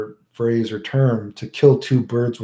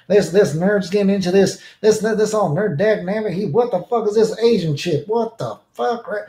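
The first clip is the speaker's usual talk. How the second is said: higher-pitched, folksy griping; mock hillbilly rant; 8 semitones higher than usual. Grumbling about a nerd's girl, mock hillbilly rant. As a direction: complain like an exasperated country old-timer.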